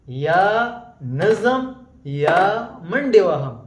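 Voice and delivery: يا نظم drawn out very slowly; slow, deliberate repetition of the proverb. Shown as a demonstration. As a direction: say it slowly so students can follow. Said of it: "teacher repeats the proverb slowly".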